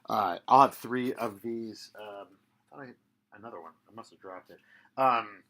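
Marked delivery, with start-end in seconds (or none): 0.1-1.9 slow, loud, deliberate; slower and louder. Shown as a demonstration. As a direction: stretch it out and project. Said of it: "I should do it slower".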